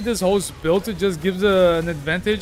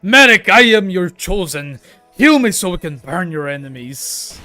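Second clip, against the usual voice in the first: grand zealot plea; 9 dB louder than usual. Pretend. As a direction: declare it like a devoted chosen one. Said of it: "I was simply not good enough to just, uh, say".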